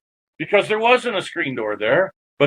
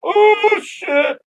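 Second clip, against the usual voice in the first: oh jumps way up, loud, shit drops back; a sudden fright as the ghost meets his eyes; startled oh shit; 3 dB louder than usual. Retold or imitated. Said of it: "and I'm like".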